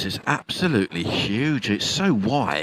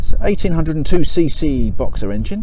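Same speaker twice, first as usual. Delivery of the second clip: presenter intro; 7 dB louder than usual. Acted. Walk-around presenter intro.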